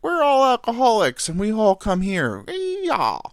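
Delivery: loud, high-pitched mock barfly voice; hammed-up rowdy bar regulars, ending way up high; Cheers. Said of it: "Cheers was like".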